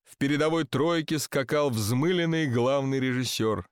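ordinary delivery, nothing marked

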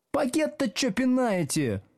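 very high, shrieking complaint; angry snap. A narrator's reading. Schoolgirl snapping at teacher over kicked bag, high and angry. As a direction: snarl it as an outraged girl. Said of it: "злобно рявкнула какая-то девица".

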